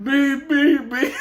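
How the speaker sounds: loud, high alarm beeps; angry beeping. Retold or imitated. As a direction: beep like an angry alarm clock. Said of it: "then he got angry".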